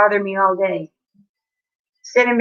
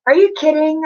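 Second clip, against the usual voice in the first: higher, louder sudden disbelief; surprised; 8 semitones higher, 4 dB louder than usual. Real, not acted. Streamer reacting to a viewer's message, startled. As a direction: caught off guard by a chat message.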